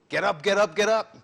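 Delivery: loud, high barked wake-up call; drill instructor yell. Retold or imitated. Father waking his stepkids at seven, drill-instructor shouting. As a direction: roust the kids like a drill sergeant. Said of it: "I like a drill, drill instructor".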